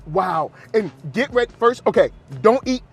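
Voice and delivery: ordinary talk, nothing marked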